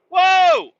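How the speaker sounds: a high, loud burst of shock; startled whoa. Retold or imitated. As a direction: jolt back in alarm. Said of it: "And it's just like".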